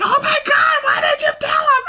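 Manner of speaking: shrill high mock shriek; imitating parents. Singer mimics parents upset at the missed show, high mock shriek. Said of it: "they're like".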